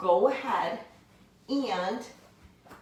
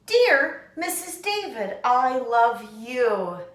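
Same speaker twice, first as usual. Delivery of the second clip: loud, high, excited greeting; excited, loving note read aloud; love and excitement; 3 semitones higher, 5 dB louder, slower than usual. Shown as a demonstration. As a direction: burst with love for your teacher. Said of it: "I say this with love and excitement".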